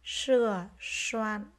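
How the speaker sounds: "Sửa soạn" very slow, each S strongly hushed; careful S sound. Shown as a demonstration. Teacher modelling "Sửa soạn" for pupils, careful drawn-out S. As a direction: lean on the S starting both words of "Sửa soạn". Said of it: "Các em nghe cô đọc lại nhé".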